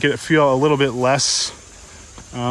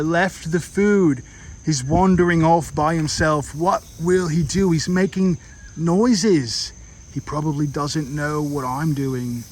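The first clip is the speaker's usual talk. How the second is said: put-on Australian accent, wildlife-documentary style. Vlogger narrating wild mouse deer to viewers in an Australian accent. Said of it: "I'm gonna stop doing this Australian accent".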